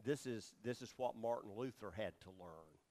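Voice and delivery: ordinary delivery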